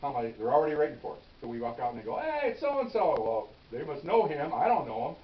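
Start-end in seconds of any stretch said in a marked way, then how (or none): 2.2-3.2 surprised greeting. Retold or imitated. Detectives greeting a known suspect.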